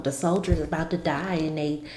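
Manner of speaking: ordinary delivery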